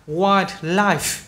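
"Wildlife" run together, middle D swallowed; joined compound. Shown as a demonstration. Teacher showing the joined word, D lost in "Wildlife". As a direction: drop the D inside "Wildlife". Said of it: "Khi nói thành chữ luôn".

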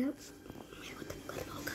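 hushed whispered aside. Real, not acted. Player muttering an aside about the kid, whispered. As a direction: whisper it so the others don't hear.

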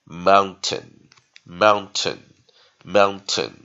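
"mountain" loud and slow, weak second syllable; weak-vowel demonstration. Shown as a demonstration. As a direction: drill "mountain" slowly, leaning on the first syllable. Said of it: "最后这个A哈".

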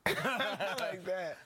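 slow laughing repeat. Real, not acted. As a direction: laugh through the words slowly.

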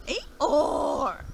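loud braying Eeyore; sharp falling bray; donkey bray. Acted. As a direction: bray back like a scornful donkey. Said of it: "the donkey looked up at him and went".

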